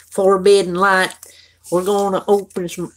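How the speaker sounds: jokey, exaggerated Florida twang; put-on Florida accent. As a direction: play up a Florida accent for laughs. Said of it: "That's my normal accent, you guys".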